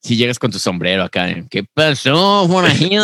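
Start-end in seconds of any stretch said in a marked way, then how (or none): 1.5-3.0 put-on high, hammy Mexican accent. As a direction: play up a Mexican drawl. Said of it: "Llego así con el acento mexicano".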